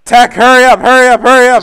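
loud hurried urging; urgent yell. Real, not acted. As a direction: yell at him to hurry. Urging teammate under fire.

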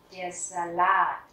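Salat with the last A stressed and long; slow "Salat", lean on the final A. Shown as a demonstration. Teacher showing where stress falls, final-syllable stress. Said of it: "Trọng âm rơi vào chữ A cuối".

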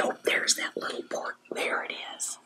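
very soft, quick whisper; hushed voiceless remark to listeners; whispered aside. Pretend. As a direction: whisper a little discovery. Hushed aside while showing picture.

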